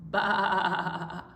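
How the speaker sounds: long quavering baa; lamb bleat. Shown as a demonstration. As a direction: bleat it out like a sheep. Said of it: "I like your sheep sounds".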